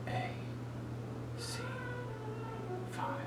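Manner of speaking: soft hearing-test whisper. Real, not acted. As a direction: whisper the letters behind the ear. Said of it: "I'm gonna whisper three random, uh, numbers or letters".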